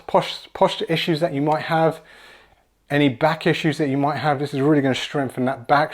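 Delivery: usual speaking voice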